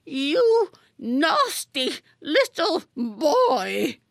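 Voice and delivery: drawn-out, high, loud spiteful snarl with a laugh; slow quivering rage. A narrator's reading. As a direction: lean in and drag each word out. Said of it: "quivering with rage".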